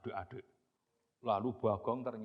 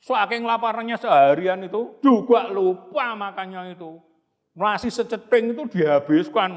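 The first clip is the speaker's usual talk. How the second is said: excited narration; 8 semitones higher, 15 dB louder than usual. A narrator's reading.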